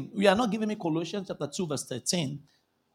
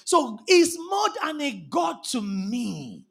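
ordinary talk, nothing marked

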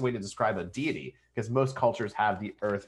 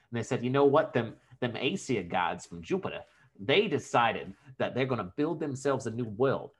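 mock New York-Jersey tough talk; New Jersey-New York accent. Podcaster retelling a Norse myth as aliens, mock New York-Jersey accent. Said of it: "some weird, like, inbred mix between, uh, New Jersey and New York".

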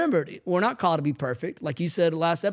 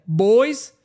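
one loud, raised call to order; stern father voice; 5 semitones higher, 8 dB louder than usual. Shown as a demonstration. Dad calling his boys to attention at home, stern. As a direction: demonstrate the dad voice that makes kids freeze. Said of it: "I'm allowed to have a stern father voice".

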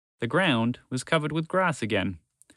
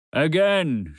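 louder, higher barked order; shouted command; 5 semitones higher, 4 dB louder than usual. Acted. The great Gonzalo ordering his dragons, loud shout. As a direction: command the group to try again.

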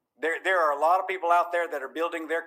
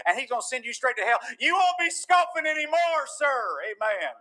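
raised pitch, rapid warning to scoffers; fiery preaching; 7 semitones higher than usual. Real, not acted. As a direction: build to a high, ringing rebuke. Preaches at full force.

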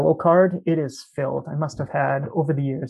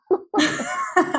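big burst of laughter; 12 semitones higher than usual. Real, not acted. Laughing at five more shots.

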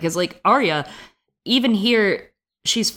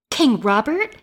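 put-on surprised girl; 8 semitones higher, slower than usual. A narrator's reading. Arya asks, forgetting herself.

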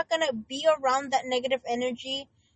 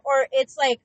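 ordinary delivery

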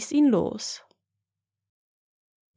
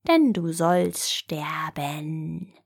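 slow, lower, breathy threat of death; menacing whisper; 5 semitones lower, slower than usual. Acted. The old giant threatens the boy, slow menacing whisper. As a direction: whisper it slowly like a looming giant.

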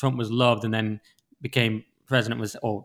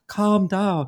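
higher mock old-lady scolding; Greta Thunberg; 6 semitones higher than usual. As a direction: mimic an old woman scolding. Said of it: "She sounds like sixty, seventy years old".

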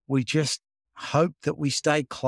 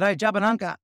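mock yell; 6 semitones higher, faster than usual. Artist hailing a mate as he pulls up alongside, mild mock yell. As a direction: imitate a man calling out a greeting, higher voice. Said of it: "he yells out".

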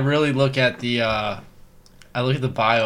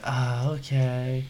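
drawn-out, soft, unsure okay; mock reluctant reply, said softly and slowly; hesitant letdown; 9 dB quieter, much slower than usual. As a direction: imitate a slow, awkward acceptance. Acting her let-down reply on Tinder.

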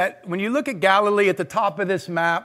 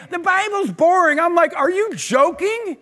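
high, mock-disbelieving outburst; as if the idea is absurd; incredulous; 9 semitones higher than usual. Mocking the complaint, incredulous.

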